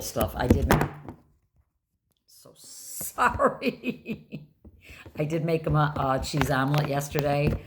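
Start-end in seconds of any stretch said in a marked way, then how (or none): 2.3-5.2 breathy giggled apology, much higher; a sheepish laughing sorry; laughing whisper. Real, not acted. Embarrassed aside mid-story.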